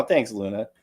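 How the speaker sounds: ordinary talk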